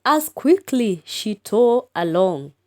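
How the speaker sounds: lifted, spirited recitation; lively poem reading. Shown as a demonstration. Teacher reciting the poem's last line, lifted. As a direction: read it with some lift. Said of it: "The last line".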